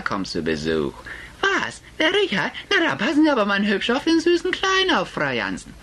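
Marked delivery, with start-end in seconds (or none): 1.4-5.7 hohe, fremde Tratschstimme einer Friseuse. Acted. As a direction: spiel die neugierige Klatschfriseuse ganz hoch. Friseuse stichelt weiter. (Friseuse begrüßt Frau Jansen, hoch und geschwätzig)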